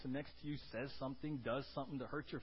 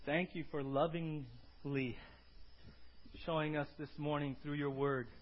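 closing prayer. Acted. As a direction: pray gently to the Lord.

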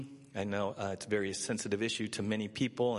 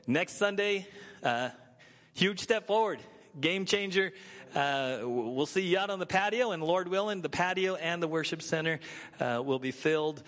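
rally the church with big news; excited; 8 semitones higher than usual. Real, not acted. Announcing reopening, huge step forward.

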